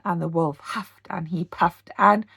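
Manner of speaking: ordinary delivery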